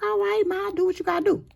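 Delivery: high-pitched put-on man's voice; easygoing man's reply. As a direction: give in to her in a high voice. Man character answers her in story.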